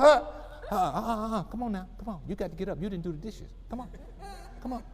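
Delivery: soft, teasing coax. Retold or imitated. Parent softly waking child at two thirty. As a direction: coax the child gently out of bed. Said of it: "Just come in and say".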